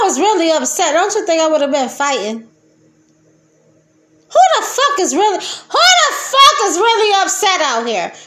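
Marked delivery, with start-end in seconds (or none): 4.3-8.1 high fast laughing shout. Real, not acted. Insisting she isn't upset, a high laughing shout.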